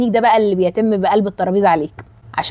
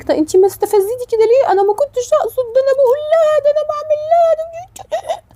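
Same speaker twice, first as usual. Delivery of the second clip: much higher, mock-innocent whine; mocking innocent friend. Friend mimicked pleading innocence in a much higher put-on voice. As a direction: play the wide-eyed friend who swears she meant nothing. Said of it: "تقول لك".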